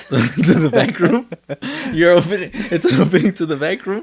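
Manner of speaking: high laughing question; laughing disbelief. Real, not acted. Laughing disbelief at friend's phrase.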